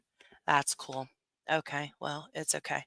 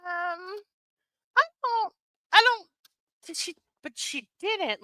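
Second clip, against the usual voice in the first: baffled; 10 semitones higher than usual. Retold or imitated. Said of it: "I'm like".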